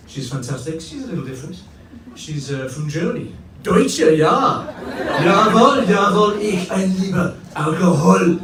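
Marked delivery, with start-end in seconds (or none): 3.6-8.4 loud hammed-up German accent, laughing through it; mock German accent. Comic mocking his German partner, loud mock German accent. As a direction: bark it like a cartoon German. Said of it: "she doesn't actually speak like that".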